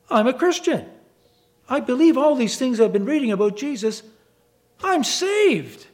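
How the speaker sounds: an overjoyed man realizing he is saved; joyful realization. Retold or imitated. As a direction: announce it like sudden joyful news. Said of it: "he realized".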